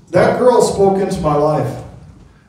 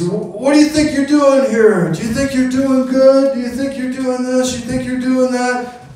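mock angry challenge, pitched higher; retold yelling; 8 semitones higher than usual. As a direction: imitate a big guy shouting him down, pitched up. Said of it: "he starts yelling at us".

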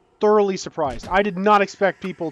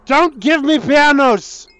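loud, high, slow scolding; exasperated shout; 8 semitones higher, 7 dB louder, slower than usual. Real, not acted. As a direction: yell in protest at the game. Scolding the game.

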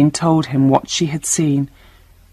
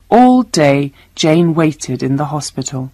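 ordinary delivery

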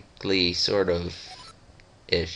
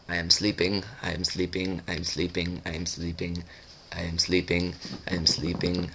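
low-key, softer monotone chant; sleepy drone; 3 semitones lower, 5 dB quieter than usual. Pretend. Player voicing sleeping game character, quiet monotone drone. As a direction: repeat it evenly like a sleep mantra.

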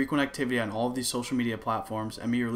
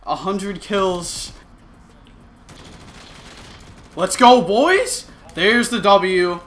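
excited shouting; 8 semitones higher, 11 dB louder, much slower than usual. Real, not acted. Celebrating a game win.

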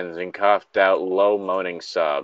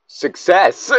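much higher, louder mock-triumphant cry; excited cheer; 9 semitones higher, 6 dB louder than usual. Real, not acted. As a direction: cheer it like you just won the round. Cheering the in-game win.

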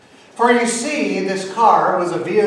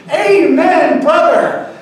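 high, loud fervent amen brother; loud, high amen to the stranger; shouted amen; 11 semitones higher, 8 dB louder, slower than usual. Retold or imitated. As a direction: bellow it like a revival. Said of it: "the man answers".

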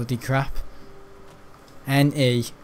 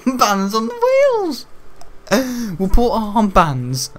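Armbands on the wheels said much higher and louder, cracking up; amused repeat; 7 semitones higher, 5 dB louder, slower than usual. Shown as a demonstration. Dad repeating his own joke to the viewers, laughing. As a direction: savour the absurd idea, laughing through it.

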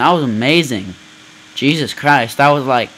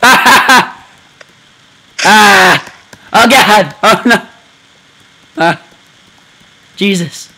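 loud, much higher frantic yelling; panicked screaming; 7 semitones higher, 6 dB louder, slower than usual. Real, not acted. Gamer screaming at the demon bike, frantic. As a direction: scream as the bike goes wild.

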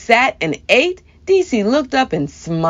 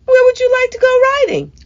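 cheerful grandma; faster than usual. A narrator's reading.

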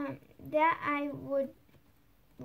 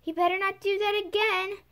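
loud high shout; 6 semitones higher, 6 dB louder than usual. A narrator's reading. Alice warning the animals to stop throwing pebbles, shouting. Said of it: "and shouted again".

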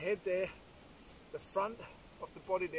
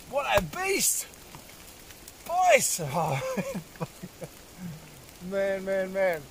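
much higher, ringing out at the sight; thrilled shout; 5 semitones higher, slower than usual. Real, not acted. Hunter marvelling at the bull, higher thrilled shout. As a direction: whoop it out to your mates.

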